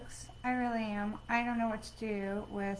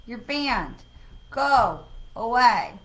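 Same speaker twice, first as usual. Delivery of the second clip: firm dismissal; 8 dB louder, much slower than usual. Real, not acted. Banning a spammer.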